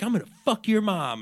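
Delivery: imitated young gamer, pitched well up; mock kid taunt. Kid trash-talking an older player, mock taunting.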